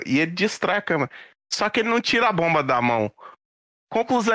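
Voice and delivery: normal talking voice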